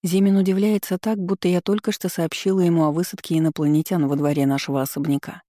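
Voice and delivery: ordinary delivery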